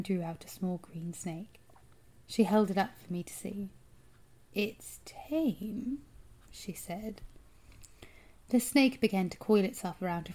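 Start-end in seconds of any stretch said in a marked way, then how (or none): 4.5-6.0 whispery, softened metallic rasp; rasping witch voice. A narrator's reading. Strange woman showing the boy her snake, rasping. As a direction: coax in a hushed witchy rasp. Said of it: "rasping quality".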